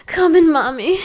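high, loud pleading cry. Acted. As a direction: cry it out at the peak. Climax plea, high and loud.

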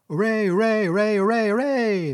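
loud, high-pitched crowd hooray; cheering. A narrator's reading. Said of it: "shouting and cheering and stamping".

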